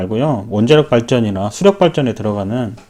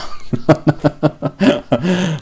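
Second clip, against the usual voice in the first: sheepish laugh; 4 semitones higher, 3 dB quieter than usual. Real, not acted. Laughs at wanting revenge.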